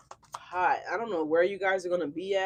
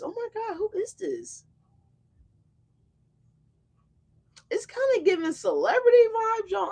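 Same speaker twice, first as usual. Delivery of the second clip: excited gasp; 14 semitones higher, 3 dB louder than usual. Real, not acted. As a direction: voice jumps high, amazed. Awed reaction to reading.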